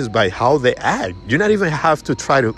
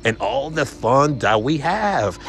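high, gushing agreement; match their excitement; excited summer voice; 7 semitones higher than usual. Shown as a demonstration. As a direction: salesman mirroring an excited prospect. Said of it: "turn yourself into a summer".

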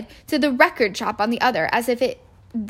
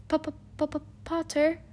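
soft, higher-pitched stutter; nervous stammer; 5 semitones higher, 9 dB quieter than usual. A narrator's reading. Professor Quirrell greeting Harry Potter, nervous stammer. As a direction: stick repeatedly on the first sound. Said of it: "stammered Professor Quirrell".

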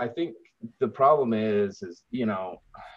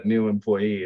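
ordinary delivery

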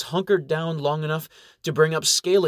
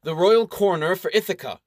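royal stated boldly with a falling close; bold announcement; 5 semitones higher, 3 dB louder than usual. Acted. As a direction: announce royal coroner proudly. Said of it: "with a bold air".

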